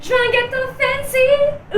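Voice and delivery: voix aiguë et traînante, en caprice; imitation capricieuse. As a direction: imite une copine qui réclame. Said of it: "L'autre, elle dit". (voix de gamine capricieuse)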